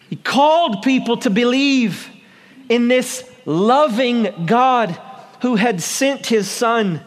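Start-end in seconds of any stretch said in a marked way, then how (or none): none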